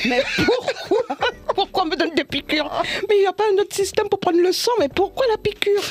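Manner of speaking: high, whiny mock wail; re-enacted tearful complaint; plaintive protest.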